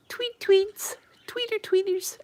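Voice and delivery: very high tweeting coo; high baby-talk cooing to pet birds. Pretend.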